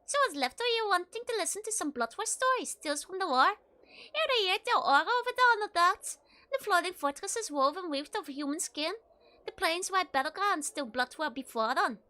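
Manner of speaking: rolling Scottish brogue, building; Scottish accent. Acted. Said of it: "like some Scottish person".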